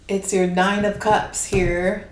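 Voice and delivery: ordinary talk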